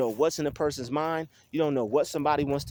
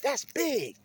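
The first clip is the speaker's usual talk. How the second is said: high emphatic refrain; 8 semitones higher than usual. Real, not acted. Repeated refrain, high pitch.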